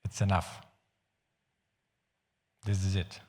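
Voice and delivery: ordinary talk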